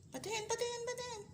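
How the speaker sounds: high squeaky begging; excited high-pitched let me see. Real, not acted. Eager to see the babies.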